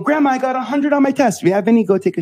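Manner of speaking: put-on high, loud eager kid then grandma's reply; boy telling grandma his test score, high and excited. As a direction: play the proud child and the dismissive grandma. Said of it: "But I would come home".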